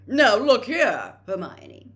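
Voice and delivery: slow, loud, lofty scolding; Percy putting Hermione in her place, slow and loud; pompous rebuke. A narrator's reading. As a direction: talk down to her like a senior official.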